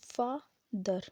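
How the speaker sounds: father, drawn out slowly; slow demo word. Shown as a demonstration. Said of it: "इसमें दो syllable". (father धीरे धीरे)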